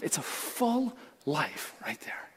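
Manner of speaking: whispered, slow and soft. Real, not acted. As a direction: breathe it out like a secret. Hushed aside after the sermon point.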